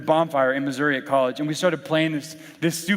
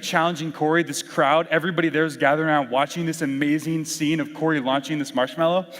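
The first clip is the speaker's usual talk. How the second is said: ordinary talk, nothing marked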